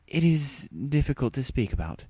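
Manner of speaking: lightly voiced regal princess; solemn princess. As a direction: speak like a troubled old-world royal. Luna, formal and troubled.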